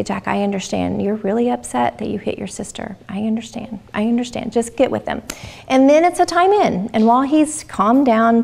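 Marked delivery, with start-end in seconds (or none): 0.0-4.5 softer, calm I understand; calm the upset child gently; soothing parent. Shown as a demonstration. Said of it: "Connect with him and say".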